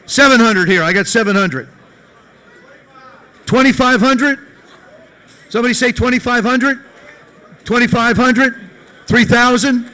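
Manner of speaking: mock auctioneer. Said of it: "I'm not an auctioneer".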